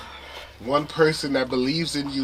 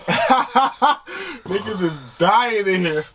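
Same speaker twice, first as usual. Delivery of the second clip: laughing; 8 semitones higher, 6 dB louder, much slower than usual. Real, not acted. Laughing at everyone suffering spice.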